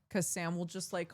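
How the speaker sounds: ordinary delivery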